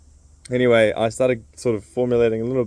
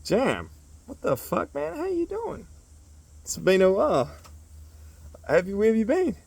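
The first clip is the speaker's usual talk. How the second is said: mock surprise; 11 semitones higher than usual. Greeting Jam after a year, in mock amazement. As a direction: act amazed to see him. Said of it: "I was like".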